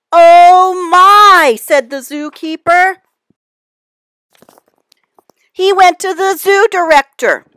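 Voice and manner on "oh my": loud, higher shocked exclamation. A narrator's reading. Zookeeper hearing the teacher was eaten, loud shock. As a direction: blurt it out in alarm.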